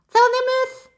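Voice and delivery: high, bouncy little puppet voice; high childlike puppet greeting the class. Acted. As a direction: play the cheeky puppet pupil. Ratoun puppet talking.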